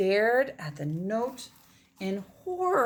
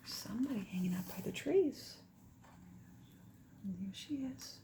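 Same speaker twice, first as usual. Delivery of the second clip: whisper; 10 dB quieter than usual. Real, not acted. Hinting at hidden figure.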